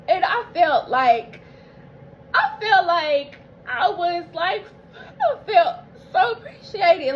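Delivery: high, breaking, crying through the words; tearful. Real, not acted. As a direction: overcome with gratitude, crying. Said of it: "if I'm getting emotional".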